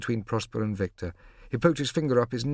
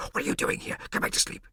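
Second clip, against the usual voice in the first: unvoiced urgent whisper; whispered scolding. A narrator's reading. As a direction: hush him so nobody wakes. Prosper hushing brother at night.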